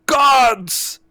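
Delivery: high, commanding shout; villain's sharp summons; calling the guards. Acted. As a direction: bellow for the guards.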